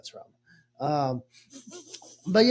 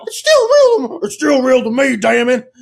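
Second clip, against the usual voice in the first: much higher, much louder teary wail; a heartbroken fan yelling through tears; sobbing hillbilly outburst; crying wrestling fan; 9 semitones higher, 12 dB louder than usual. Pretend. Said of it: "Why is he a hillbilly?".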